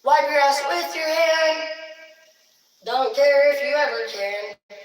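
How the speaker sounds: high, loud ranting parent; acted furious tirade; mock parental rage. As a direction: rail at the kids in hammed-up outrage.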